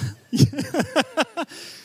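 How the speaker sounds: high burst of real laughter; amused laugh. Real, not acted. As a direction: laugh out at the audience's reply.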